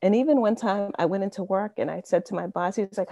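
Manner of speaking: normal talking voice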